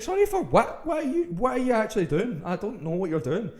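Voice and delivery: very high, loud, incredulous yelling; exasperated outburst. Retold or imitated. Storyteller re-enacts answering the date's apology, exasperated outburst. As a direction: throw the apology back in loud, squeaky disbelief.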